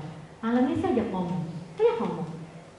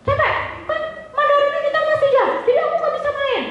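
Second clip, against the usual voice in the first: put-on much higher child voice, whining; complaining child. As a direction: whine like a tired kid who wants to play. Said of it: "Dengan tenang".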